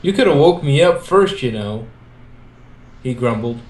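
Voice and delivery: plain reading voice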